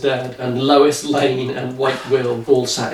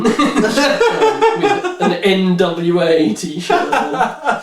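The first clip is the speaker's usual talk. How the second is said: laughing; 8 semitones higher, much slower than usual. Real, not acted. Laughing through the narration.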